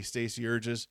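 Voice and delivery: ordinary delivery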